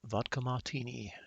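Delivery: "martini" with the R dropped, as "mah-tini", snobby British; fake British accent. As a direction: redo "Vodka martini" in posh British, no R in "martini".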